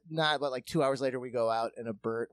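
ordinary delivery

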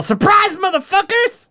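triumphant yell bursting into the lair; yelled surprise. Acted. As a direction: burst in shouting it. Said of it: "And I yell".

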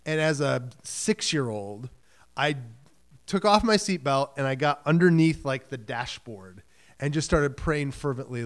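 ordinary talk

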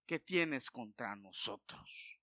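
slow, drawn-out demon plea; demon pleading. Retold or imitated. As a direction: let the demon beg slowly. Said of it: "el demonio viene y le dice".

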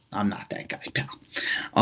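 breathy mock-menacing whisper. Podcaster to listeners, whispering the line again. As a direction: hush it like a private threat.

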